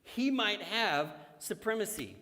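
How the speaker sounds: preacher reading Colossians to congregation; scripture reading. Acted. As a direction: proclaim the passage to the church. Reads Colossians aloud.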